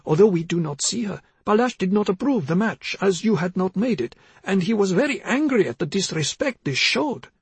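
put-on high-pitched, rambling elderly-mother character; confused mother. A narrator's reading.

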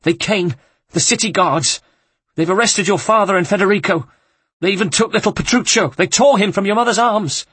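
high, loud, panicked outburst. Acted. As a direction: blurt it all out in terror. Housekeeper blurts out the arrests.

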